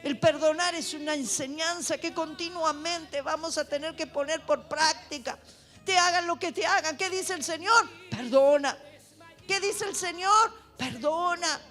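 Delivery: loud, impassioned sermon; fervent preaching. Acted. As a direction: preach with rising fire. Preaches at full force.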